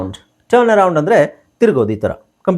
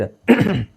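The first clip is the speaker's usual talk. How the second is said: deliberate little throat clear; 4 semitones higher than usual. Shown as a demonstration. Teacher gives learners an example from own habit, demonstrative throat clear. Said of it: "ಈ ಥರ ಮಾಡ್ತೀವಲ್ಲ".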